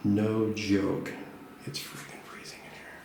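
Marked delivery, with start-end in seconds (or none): 1.6-3.0 whispering while shivering; hushed, shivering from cold. Real, not acted. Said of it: "I'm literally shivering right now".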